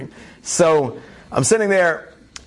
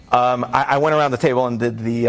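ordinary talk

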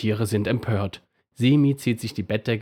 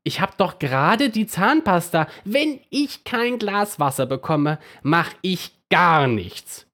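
lightly voiced toothbrush refusing, much higher; toothbrush scolding back; 8 semitones higher than usual. A narrator's reading. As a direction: scold back with a defiant threat. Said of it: "schimpft die Bürste zurück".

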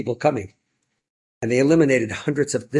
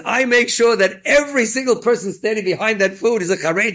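higher, louder, proud imitation of the donor; lightly voiced proud donor declaring his plan; proud quote; 10 semitones higher, 4 dB louder than usual. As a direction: quote him with gusto. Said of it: "He says".

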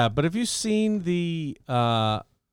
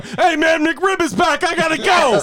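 much higher, louder carefree shout; goofy excuse voice; 5 dB louder than usual. Pretend. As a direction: blurt it out like you're bailing for fast food. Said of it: "I imagine guys on the comms like".

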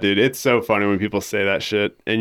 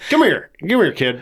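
pitched-up, put-on calling voice; parent calling a kid; 8 semitones higher than usual. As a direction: act out a parent calling over a nameless child. Parent calling a child whose name's forgotten.

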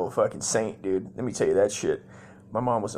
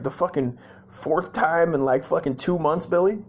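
mom's angry disbelief; 7 semitones higher, 3 dB louder than usual. Retold or imitated. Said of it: "My mom's like".

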